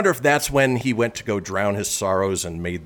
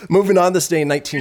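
fast announcer read; 8 semitones higher, 6 dB louder, faster than usual. Acted. Segment announcement.

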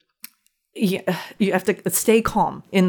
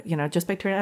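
normal talking voice

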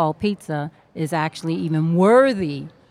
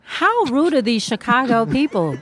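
indignant; 6 semitones higher than usual. Acted. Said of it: "Please rephrase".

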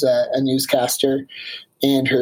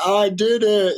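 mock victory shout at the summit; a hammed-up champion's yell; triumphant; 7 semitones higher than usual. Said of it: "a Rocky Balboa moment".

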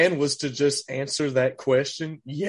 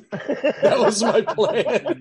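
high, drawn-out words through laughter; laughing; 8 semitones higher, 4 dB louder, much slower than usual. Real, not acted. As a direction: crack up while admitting it. Laughs at his own failed plan.

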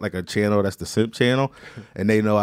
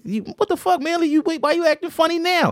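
much higher, squeaky indignant protest; mock outraged teen. Student protesting to his teacher Manley, high mock outrage. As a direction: squeal it like a wronged sixteen-year-old. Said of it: "And I'm just like".

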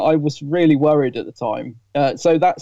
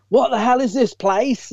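slow, high comic sketch character voice; a hint of a brash sketch show character; lightly voiced comic Donna, drawn out; sketch comedy Donna; 8 semitones higher, slower than usual. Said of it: "she sounds a little bit like one of her characters from a sketch".

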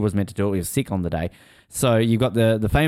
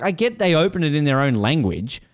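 ordinary delivery

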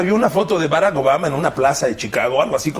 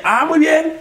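higher, put-on voice of a cop asking questions; mock police officer; 10 semitones higher, 3 dB louder than usual. Cop at checkpoint quizzing driver, higher mock voice. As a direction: play the checkpoint cop, smug and casual.

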